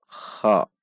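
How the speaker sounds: "Kha" dengan gesekan kh ringkas dan halus; lebih halus. Shown as a demonstration. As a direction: gesek kh tipis pada "Kha". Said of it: "namun dibaca lebih halus". ("Kha" tanpa riak kasar)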